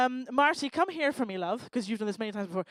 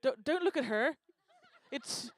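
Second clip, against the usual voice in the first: ordinary delivery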